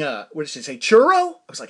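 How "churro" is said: high, loud questioning "Churro?"; puzzled server. Retold or imitated. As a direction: imitate a confused drive-thru worker calling out. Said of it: "She kept going".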